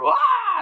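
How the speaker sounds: very high, short mock scream. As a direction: imitate a distant victim screaming. Imitates victim's scream heard on phone.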